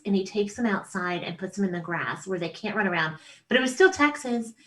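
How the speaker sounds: usual speaking voice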